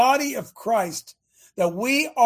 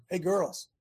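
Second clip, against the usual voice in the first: normal talking voice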